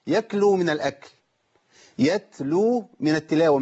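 ordinary talk, nothing marked

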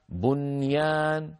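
slow بنيان with a distinct N before the Y, bun-yaan; clear unmerged noon. Shown as a demonstration. Teacher models the correct word, clear N in بنيان. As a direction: draw out بنيان, keeping the N fully sounded. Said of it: "لا ندغم النون في الياء بعدها".

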